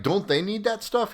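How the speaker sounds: a high, incredulous demand from the commander. Retold or imitated. Commander grilling a junior soldier, high and incredulous.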